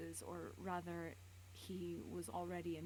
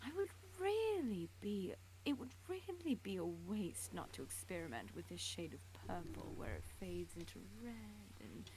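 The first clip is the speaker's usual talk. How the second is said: menacing villain voice. Acted. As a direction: savour each word like a collector. Villain voice in fanfic reading.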